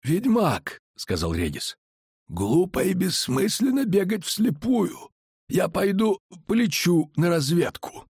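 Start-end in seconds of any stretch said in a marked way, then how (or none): 0.0-0.8 high-pitched calling voice; high, drawn vampire voice; vampire Regis. A narrator's reading. 2.3-8.0 high, slow, deliberate voice; high, measured vampire; vampire character voice. A narrator's reading.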